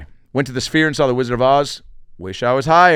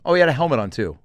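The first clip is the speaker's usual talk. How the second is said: ordinary delivery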